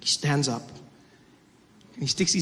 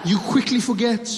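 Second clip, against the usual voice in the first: raised, declamatory lament of betrayal; theatrical wounded speech, pitched up; mock-tragic reproach; 6 semitones higher than usual. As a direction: lament it like a betrayed stage hero.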